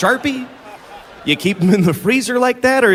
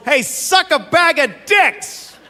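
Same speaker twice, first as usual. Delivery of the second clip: high, slow drawn-out yell; mock yell; 11 semitones higher, slower than usual. Road-rage driver yelling through the window at him. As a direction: call it out across the lanes. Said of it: "he yells".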